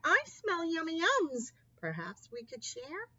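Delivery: a lightly voiced eager badger; cheerful badger. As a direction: sniff it out hungrily, lightly voiced. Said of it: "Badger says with a grin".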